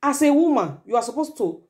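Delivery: ordinary talk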